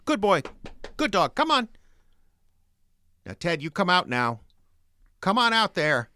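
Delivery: high, loud calls for a dog; calling a lost pet. Acted. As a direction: call the dog out from the shed.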